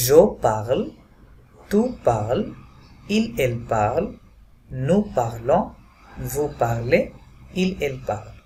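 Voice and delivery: careful French conjugation, each form said slowly; French pronunciation demo. Shown as a demonstration. Teacher demonstrating French pronunciation to students. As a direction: model the French sounds for learners. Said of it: "তখন উচ্চারণটা কেমন হবে?".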